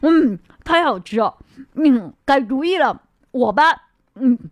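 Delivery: chewing loudly while talking; mouth full. A narrator's reading. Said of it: "一边大声嚼，一边含糊不清地说".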